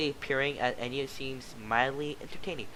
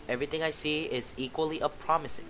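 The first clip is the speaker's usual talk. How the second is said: usual speaking voice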